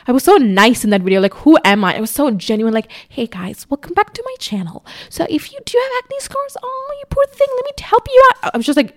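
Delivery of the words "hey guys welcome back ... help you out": much higher sugary beauty-vlogger voice; mock her past self's syrupy channel intro; sugary high tutorial voice, mocked; old sweet YouTuber voice. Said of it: "I don't talk like that anymore".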